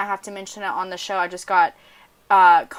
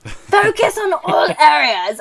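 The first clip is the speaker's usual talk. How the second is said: slow, loud, higher mock Ozzy slur, laughing through; [name] voice; 10 semitones higher, 10 dB louder, slower than usual. Host trying a celebrity voice for the next tip, hammed-up Ozzy. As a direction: ham up a rock star drawl. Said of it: "I should do, like, an [name]".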